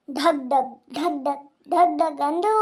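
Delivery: slow, low thumping heartbeat sound; imitated heartbeat. Neighbour acting out a pounding heart, slow and low.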